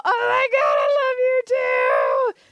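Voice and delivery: high, tearful gushing cry of love; overwhelmed, teary, high-pitched delight; gushing emotional. Retold or imitated. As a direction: squeal it like you're about to cry happy tears. Retold reaction to friend's message.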